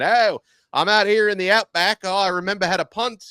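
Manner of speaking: high mock Australian accent. Podcaster voicing the outback punter, bad Australian accent. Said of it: "I'm trying to do the Australian accent".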